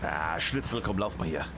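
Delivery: put-on low muttered German accent aside. Caster urges player Schnitzel on in low German accent. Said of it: "I'm doing a German accent there".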